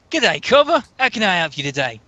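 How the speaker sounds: a lightly put-on Australian accent, high on the greeting. GM voices the contact in an Aussie accent. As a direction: GM doing a cheery Aussie contact. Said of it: "subject to my Australian accent".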